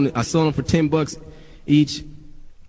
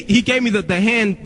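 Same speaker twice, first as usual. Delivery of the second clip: ordinary talk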